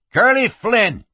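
high, loud, boastful name-drop; proud. A narrator's reading. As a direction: announce yourself with pride. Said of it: "the rider said with pride".

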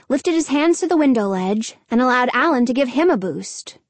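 usual speaking voice

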